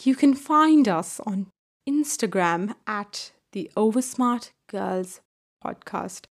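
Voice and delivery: hammed-up Thatcher-style British accent, trailing off; slow, understated mock Thatcher British accent; bad Margaret Thatcher accent. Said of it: "say it in a Margaret Thatcher accent".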